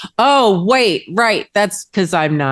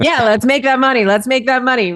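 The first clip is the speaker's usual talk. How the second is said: higher, hammed-up money-chasing cheer; mock hustle hype; 7 semitones higher than usual. Past self hyping up chasing money, in mock cheer. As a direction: pump it up like a fake go-getter. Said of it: "where I'm just like".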